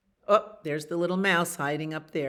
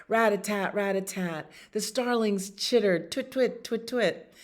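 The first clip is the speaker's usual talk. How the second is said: lively, higher-pitched picture-book reading; story narration; 4 semitones higher, 4 dB louder than usual. A narrator's reading. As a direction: read it to children with bouncy emphasis. Reads a picture book aloud.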